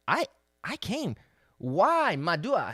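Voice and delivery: impassioned preached outcry; anguished cry. Acted. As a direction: cry out as the Lord calling with no answer. God's anguished cry in scripture.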